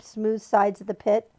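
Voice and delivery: plain reading voice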